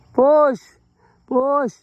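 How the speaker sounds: loud, high self-cheer; shouting push. Real, not acted. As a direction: yell it to push yourself uphill. Pushing bike uphill, cheering self. (sigaw na pampalakas-loob)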